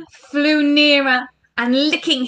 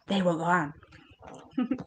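ordinary delivery, nothing marked

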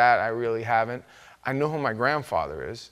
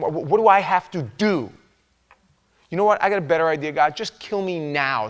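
louder, frustrated cry to God; exasperated outburst; 4 semitones higher, 5 dB louder than usual. Retold or imitated. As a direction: throw up your hands and vent at God. Moses complaining to God.

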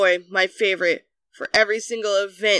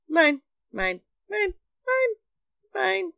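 high, repeated gull cries; short high seagull calls; seagull impression; 11 semitones higher than usual. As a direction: squawk it like a greedy seagull.

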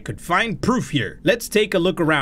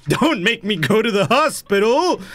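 whiny pleading; 9 semitones higher than usual. Pretend. Begging the game, rising whine.

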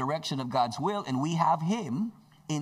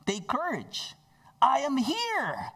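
slow, higher, stressed I am here; Jesus in the storm, emphatic and slow; emphatic reassurance; 9 semitones higher, slower than usual. Acted. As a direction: lean on each word, drawn out. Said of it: "he had the emphasis".

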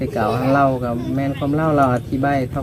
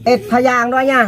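high, loud call to grandma; louder call to grandma; calling out a request; 9 semitones higher, 4 dB louder than usual. Real, not acted. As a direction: shout the question across the room. Asking grandma across room.

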